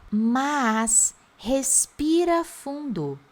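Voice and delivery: drawn-out slow demo; slow teacher demo. Shown as a demonstration. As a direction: say it slowly for them to repeat. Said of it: "Já pega esse inglês aqui".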